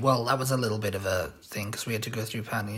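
normal talking voice

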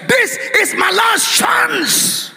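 slow, high preacher's shout; desperate cry. Acted. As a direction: cry out each word as a last plea. Voices desperate Bartimaeus crying out.

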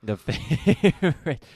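loud, drawn-out word broken up by laughter; laughing. Real, not acted. Host laughs while echoing a teasing remark.

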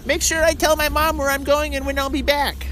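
put-on high kid voice, chirpy and proud; lightly voiced child reciting. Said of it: "another one's like".

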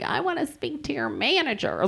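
mocking herself as a demanding customer, high; entitled customer. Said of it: "that's a crazy thing".

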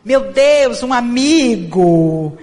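drawn-out, loud "um amigo!" of letdown; mock disappointment. Spirit-centre visitor complaining the message came from a friend, mock dismay. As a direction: whine it as if insulted by a mere friend.

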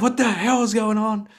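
high, startled "what the hell" in a put-on voice; bewildered outburst. Trader back from the toilet finds chaos, high bewildered outburst.

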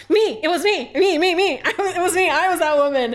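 loud, high, laughing me me me; claiming credit, laughing and loud; excited shouting. Retold or imitated. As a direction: shout it out in delight. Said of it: "And I was like".

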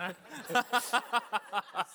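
a big burst of laughter; loud laugh. Real, not acted. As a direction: laugh out loud at your own joke. Laughs after joking.

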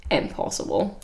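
breathy whispered disbelief. Real, not acted. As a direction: hush it, barely voiced, incredulous. Disbelieving aside to co-host.

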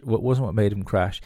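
ordinary talk

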